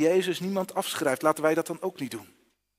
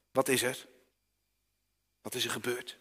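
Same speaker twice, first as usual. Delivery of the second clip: tender mother; 4 semitones lower, 5 dB quieter than usual. Acted. Mother comforts a fallen child.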